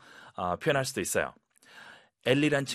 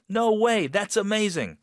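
very high, amazed exclamation; happily surprised; 8 semitones higher, 3 dB louder, much slower than usual. Shown as a demonstration. Teacher modelling reaction to a perfect score, happily surprised. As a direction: burst out in delighted surprise. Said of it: "이렇게 하셨죠".

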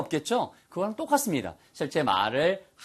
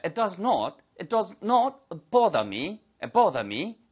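higher-pitched British English, rounded "o" in "bother"; British take on "bother", final r dropped; British accent; 4 semitones higher, much slower than usual. Shown as a demonstration. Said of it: "영국식 발음이 되겠죠". (영국식 영어 발음)

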